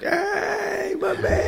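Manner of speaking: very high, excited dog greeting. Retold or imitated. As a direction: squeal it like an eager dog. Friendly dog greeting voiced.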